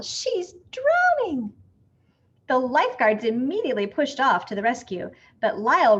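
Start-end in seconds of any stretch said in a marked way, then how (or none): 0.0-1.5 high, slow panicked cry; terrified cry for help; panicked scream. A narrator's reading. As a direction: scream that the child is drowning. Child drowning, panicked mother.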